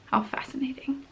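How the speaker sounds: dry whispered aside. Real, not acted. Wry whispered aside to her viewers.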